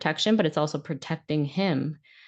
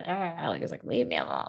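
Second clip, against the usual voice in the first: imagined irritated mutter; 4 semitones lower, 5 dB quieter than usual. Retold or imitated. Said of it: "I wanted to be like".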